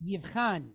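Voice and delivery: "yivchan" with CH from the back of the throat; throaty CH. Shown as a demonstration. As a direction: scrape the CH in "yivchan". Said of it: "C H like in the back of the throat".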